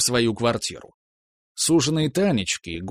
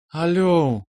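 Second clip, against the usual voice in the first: a hint of put-on languor, higher; languid; 6 semitones higher than usual. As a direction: answer with mock sultry ease. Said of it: "изображая несвойственную ей томность". (наигранная томность)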